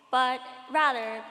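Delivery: ordinary talk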